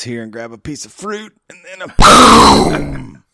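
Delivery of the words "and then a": loud, drawn-out mouth-made boom; imitated explosion. Host acting out the self-destruct, loud imitated explosion. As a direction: blow up with a long vocal blast.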